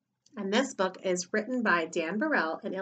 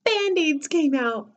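high, drawn-out storytelling reveal; slow singsong surprise, high-pitched; 7 semitones higher, 3 dB louder, slower than usual. A narrator's reading. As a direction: stretch it out high for the young listeners.

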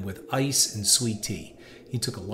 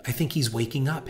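hissed; 4 semitones higher than usual. A narrator's reading. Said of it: "hissed a female voice".

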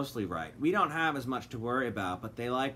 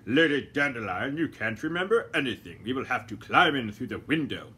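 lightly voiced gentleman; 5 dB louder than usual. A narrator's reading. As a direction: say it unhurried, brushing her off. Doctor Fogg.

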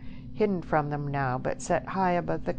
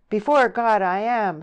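loud, raised, sincere vow; heartfelt cry; 7 semitones higher, 10 dB louder than usual. A narrator's reading. Stephen swearing his love to Deborah, crying out. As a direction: cry it out like an oath. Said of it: "he cried, with truth".

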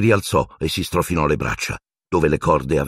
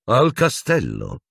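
slow, higher mock query; silky, coaxing; 6 semitones higher, slower than usual. Teacher questioning his captive, slowly and silkily. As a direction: draw it out with false sweetness. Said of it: "disse Piton suadente".

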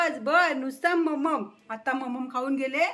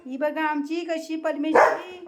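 ordinary talk

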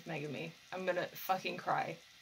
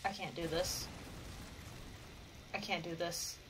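overwhelmed murmur; 11 dB quieter than usual. Real, not acted. Overwhelmed watching.

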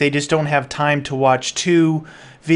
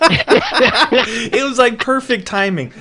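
laughing through the words; giggling while talking; 8 semitones higher, 4 dB louder, much slower than usual. Real, not acted. Laughing at the glitch.

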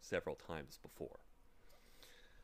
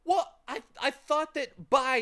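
put-on a higher, louder desperate boy; 12 dB louder than usual. Boy trying to explain to the bird, desperate. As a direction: plead quickly to get a word in. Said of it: "he tried again desperately".

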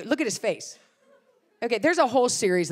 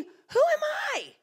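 much higher, slow and awed; a dazed question to herself; stunned disbelief. Retold or imitated. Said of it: "standing there in shock, thinking".

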